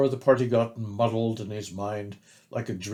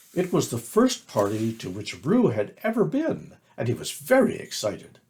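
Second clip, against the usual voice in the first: usual speaking voice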